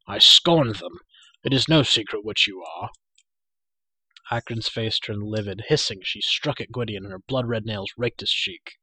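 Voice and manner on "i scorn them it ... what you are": forceful, loud defiance; scornful cry. A narrator's reading. Gwydion defies Akron and her promises, loud scorn. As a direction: throw her offer back loudly. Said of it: "cried Gwydion".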